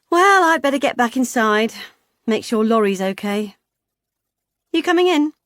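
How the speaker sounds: high, loud jolly chatter; jolly hearty woman. Acted. Vicky dismissing the girl to the couple, jolly and loud. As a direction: bluster on cheerfully.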